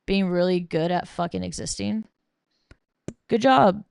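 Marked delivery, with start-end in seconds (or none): 3.3-3.9 sarcastic. Real, not acted. Sarcastic praise for mere existing.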